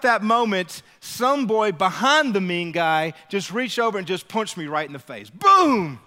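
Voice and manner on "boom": ordinary talk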